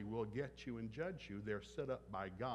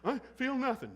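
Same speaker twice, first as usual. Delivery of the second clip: mock defiant child, much higher and louder; 12 semitones higher, 10 dB louder than usual. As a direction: play the sulky kid shouting back.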